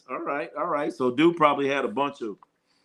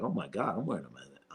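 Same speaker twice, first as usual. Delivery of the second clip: low disbelief; 9 semitones lower, 12 dB quieter than usual. Retold or imitated. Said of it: "I'm like".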